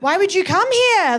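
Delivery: brisker, livelier mock bafflement; insistent question. Aussies pressing an emigrant for reasons, insistent disbelief. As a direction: ask it pointedly, at normal pace. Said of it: "'cause they're like".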